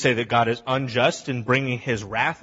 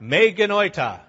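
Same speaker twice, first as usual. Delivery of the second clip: "Me genoita" said louder and higher, hammered out; emphatic Greek phrase; 5 semitones higher, 5 dB louder than usual. Shown as a demonstration. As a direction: proclaim "Me genoita" with force. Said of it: "you all can say this with me".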